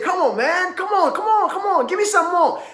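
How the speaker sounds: high, mocking taunt. Holyfield laughing at Tyson in the ring, high mocking taunt. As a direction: goad him, grinning, begging for another punch. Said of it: "He said".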